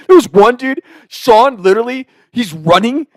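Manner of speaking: ordinary talk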